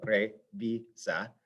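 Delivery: "revisa" slowly split into re, vi, sa; syllable by syllable. Shown as a demonstration. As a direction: count out the syllables of "revisa" with small gaps. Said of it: "to last syllable".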